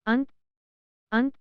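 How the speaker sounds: अंत with the nasal as न before त; careful demo word. Shown as a demonstration. Teacher models अंत for learners, careful demo. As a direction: model अंत clearly twice. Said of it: "जैसे".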